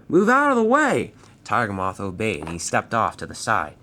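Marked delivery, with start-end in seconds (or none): 0.1-1.1 very high, loud shouted order in a different voice; yelled. Acted. Man from the control tower yells at Tiger Moth to move, loud. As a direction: bellow the command across the airfield. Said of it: "he yelled".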